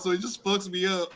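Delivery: normal talking voice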